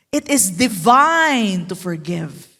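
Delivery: usual speaking voice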